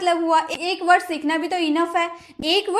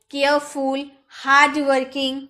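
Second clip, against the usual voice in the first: ordinary delivery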